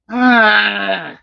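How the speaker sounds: high, loud frustrated groan; growled ugh. A narrator's reading. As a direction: growl goodbye in anger. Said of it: "Sammy growled".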